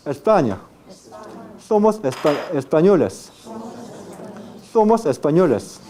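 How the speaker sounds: "Somos españoles" with retracted Spain-style s; tongue-tip s throughout "España" and "Somos españoles"; Castilian apical s. Shown as a demonstration. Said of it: "Pero en España".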